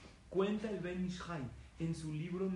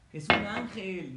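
exclamación enfática; 4 semitones higher, 10 dB louder, slower than usual. Acted. Stresses force of angel's blow.

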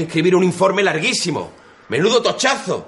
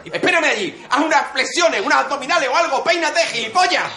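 exasperated scolding; 9 semitones higher than usual. Acted. Sergeant scolds cadet angrily.

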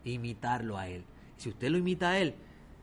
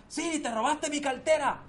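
high, loud furious accusation; angry accusation; 13 semitones higher, 4 dB louder than usual. Acted. Robbed man accuses the third man, high and furious. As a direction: confront him, outraged.

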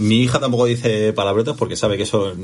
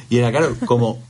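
usual speaking voice

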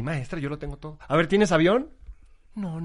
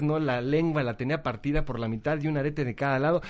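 ordinary talk

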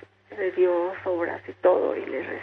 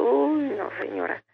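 mock discouraging reply, higher at the start; dismissive retold refusal; 7 semitones higher than usual. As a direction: imitate a woman doubtfully waving off a request. Retold woman dismissing her request.

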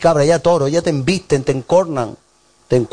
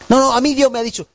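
higher, insistent refusal; mock stubborn believer; 9 semitones higher, faster than usual. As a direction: play the member brushing off counsel, higher. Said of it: "stubborn member refusing".

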